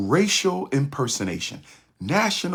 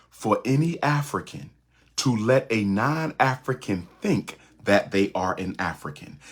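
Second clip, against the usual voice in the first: ordinary talk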